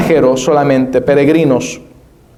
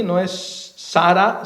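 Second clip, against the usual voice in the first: usual speaking voice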